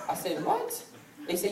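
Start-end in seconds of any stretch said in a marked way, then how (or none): 0.5-0.8 very high, squeaked question; mock shock. Grandson refused by his grandparents, comically shocked. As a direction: imitate incredulity with pitch leaping up.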